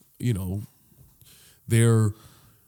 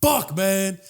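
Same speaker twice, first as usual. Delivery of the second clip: higher-pitched mock outburst; retold yell; 6 semitones higher than usual. Customer upset over sold-out food, mock yelling. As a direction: cry it out like a gutted customer. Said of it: "he just yells".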